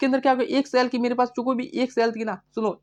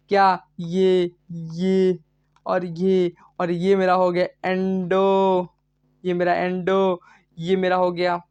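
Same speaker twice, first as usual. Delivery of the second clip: slow, stretched-out words while drawing; name each part slowly, holding the endings; drawn-out labelling; slower than usual. Real, not acted. Teacher labelling endo, ecto and meso for students, drawn out.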